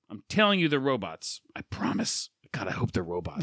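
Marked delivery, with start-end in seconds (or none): 1.5-3.4 whispered promise. Real, not acted. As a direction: breathe it out as a whisper. Whispered aside.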